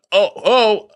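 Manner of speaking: high, loud mock "oh, oh"; imitated moans. Imitating the man flipped over in the scene, moaning. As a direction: act out startled moans. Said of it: "making these noises and these faces".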